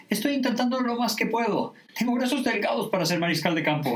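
high-pitched, flustered excuse; pleading protest. Acted. Troy pulling the rope to lift Pierce, pleading. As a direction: protest that it's your best effort.

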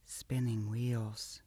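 very soft, very slow, awed hush; reverent whisper. Acted. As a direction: breathe it out with reverence. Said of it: "Araya's voice dropped to a reverent whisper".

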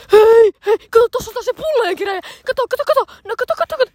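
high, excited calling out; loud high-pitched hey, then urgent repeats; imitated shouting. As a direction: imitate a friend yelling high across the street. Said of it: "sitten Diana huutaa".

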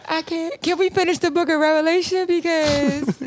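high, whiny mock plea to a boyfriend; mock pleading partner. Mocking a partner begging not to break up, high and whiny. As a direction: beg to delay the breakup, hammed-up. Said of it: "mock pleading partner".

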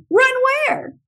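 high-pitched retold yelp; imitated startled child. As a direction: act out a child's wide-eyed question. Voices child answering adults' body language.

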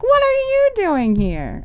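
high, slow, drawn-out surprised question; surprised counselors. Retold or imitated. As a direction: counselors spotting Sarah in town, high and surprised. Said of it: "They all were like".

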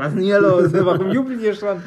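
loud, puzzled dad barging in; a grumpy dad asking what's going on; father's voice. Retold or imitated. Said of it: "mein Vater ist reingekommen und gesagt".

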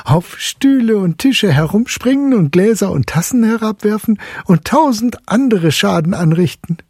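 high, amused mother's voice; amused mother teasing her son; laughing mother. A narrator's reading. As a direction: tease the boy with a laugh in it. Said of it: "fuhr die Medizinalrätin lachend fort".

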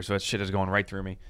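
ordinary delivery

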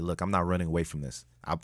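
ordinary delivery

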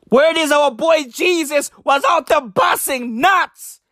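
ordinary talk, nothing marked

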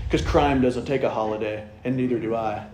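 low, hammed-up cop show one-liner; lightly voiced cop drama hero; dramatic cop line. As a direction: deliver it like a TV detective removing his glasses. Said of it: "take their glasses off dramatically".

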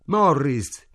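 a narrated cry, falling at the end. A narrator's reading. Ida calls to Morris stretched on the floor, crying out. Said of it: "gridò Ida".

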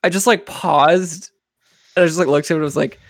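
ordinary delivery

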